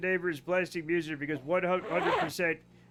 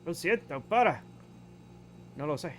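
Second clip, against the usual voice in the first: mock Spanish; 3 semitones lower, 5 dB quieter, faster than usual. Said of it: "He talks in Spanish".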